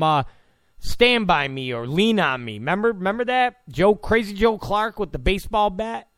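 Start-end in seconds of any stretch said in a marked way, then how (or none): none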